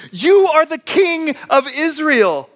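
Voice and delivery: high, drawn-out scolding of a husband; condescending wife. Acted. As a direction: order him about, slow and high-pitched, as to a child. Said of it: "She, like, goes condescending".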